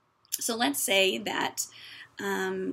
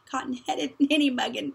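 laughing; 4 semitones higher, 5 dB louder than usual. Real, not acted. Laughs mid-insult in the quote.

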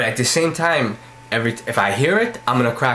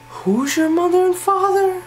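high squeaky voice; slower than usual. Pretend. Repeating the question in voices.

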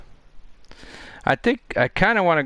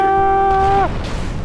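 very high, drawn-out wail; frustrated wail. Real, not acted. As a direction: wail as the attempt slips away. Player failing at the game.

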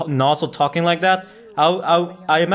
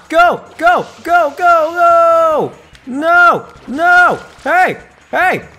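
excited yelling; 9 semitones higher, 6 dB louder than usual. Real, not acted. Yelling during game chase.

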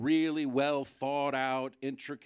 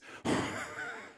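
squeaky laugh; 4 dB quieter than usual. Real, not acted. High unvoiced squeal laugh.

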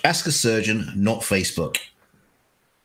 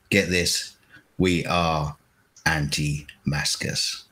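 ordinary talk, nothing marked

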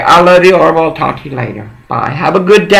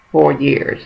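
ordinary delivery

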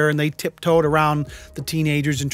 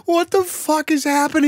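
much higher, stunned; incredulous; 12 semitones higher than usual. Real, not acted. Vlogger reacting to the absurd clip, incredulous. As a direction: cry out in disbelief.

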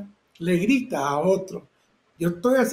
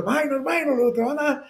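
warning shout; 8 semitones higher than usual. Retold or imitated. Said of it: "Y yo le grito".

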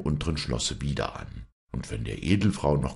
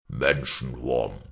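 giant roaring; 4 semitones lower, slower than usual. Acted. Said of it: "brüllte der Riese".

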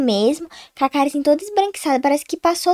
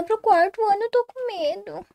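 scared pleading; 6 semitones higher than usual. Acted. Menina com medo pede companhia.

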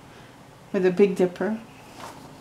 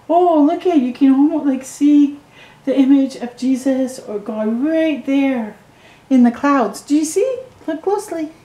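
excited pointing out; 6 semitones higher than usual. Real, not acted. Adult showing a child clouds in a picture, high eager delight.

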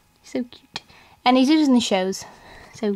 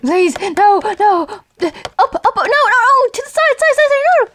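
pleading shout; 9 semitones higher, 5 dB louder than usual. Real, not acted. Struggling, pleading loudly.

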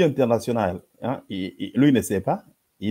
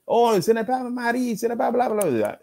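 high-pitched mock protest; mocking objector; 9 semitones higher than usual. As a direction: mimic a rival translator objecting, pitched way up. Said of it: "et l'autre disait".

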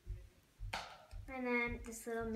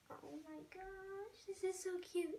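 adoring coo; 8 semitones higher, 3 dB quieter than usual. Real, not acted. Vlogger seeing the doll's little toenails, adoring. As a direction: melt over it in a high voice.

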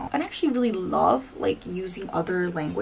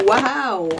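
excited wow; 4 semitones higher, 6 dB louder than usual. Real, not acted. Reacting to the foundation.

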